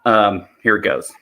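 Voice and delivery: ordinary talk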